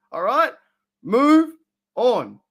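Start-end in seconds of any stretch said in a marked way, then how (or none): 1.0-2.4 emphatic. Real, not acted. Emphatic call to move on.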